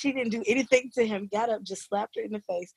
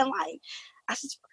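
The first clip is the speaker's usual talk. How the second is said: usual speaking voice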